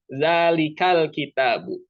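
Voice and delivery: "Zalikal" with its l said slowly and clearly; slow recitation showing a clear lam; izhar demo. Shown as a demonstration. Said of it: "Hurufnya itu harus dibaca jelas. Izhar". (contoh izhar, "Zalikal kitabu" dibaca pelan dan jelas)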